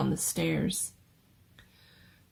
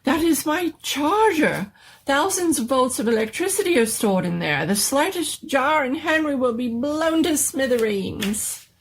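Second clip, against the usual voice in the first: higher, louder, urgent explanation; frantic warning; 8 semitones higher, 4 dB louder than usual. Acted. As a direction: warn them before it blows. Doctor warns in panic.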